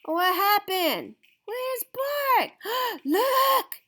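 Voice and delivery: high, alarmed cries; high surprised shouted call. Acted. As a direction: point up and shout in shock. Crowd sees Bark carried off.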